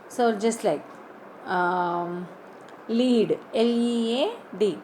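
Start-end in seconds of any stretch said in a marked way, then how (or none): 2.9-3.4 "lead" with a long ee, like leed. Shown as a demonstration. Teacher shows class the word meaning guide, long-ee "lead". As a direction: say "lead" to rhyme with need. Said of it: "I pronounce the word lead as".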